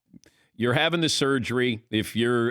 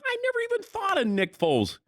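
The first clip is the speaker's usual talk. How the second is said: much higher, squeaky mock coach voice; mock giddy coach. Coach gushing about his old quarterback in a much higher voice. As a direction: lampoon the coach as a giddy, high-pitched schemer. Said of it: "Frank Reich is going".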